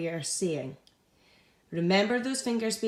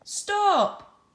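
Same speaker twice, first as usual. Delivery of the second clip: high, loud shouted Stop; 13 semitones higher, 6 dB louder than usual. Shown as a demonstration. Teacher acting Bob in a class example, shouting. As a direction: call out Stop sharply. Teacher voices Bob's exclamation.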